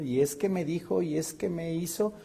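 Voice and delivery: lightly voiced whiny complainer; a hint of the brooding comic character; Eugenio Derbez character. Preacher mimics a resentful comic character for the congregation. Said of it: "como el personaje de Eugenio Derbez".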